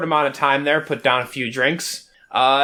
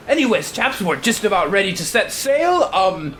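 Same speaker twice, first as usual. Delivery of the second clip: high, proper naval captain voice; brisk, polite officer giving orders; posh captain; 4 semitones higher than usual. Acted. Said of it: "And he continues".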